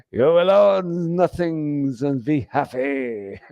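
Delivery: put-on slow, heavy German accent, villain-like. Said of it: "I think it's the German accent".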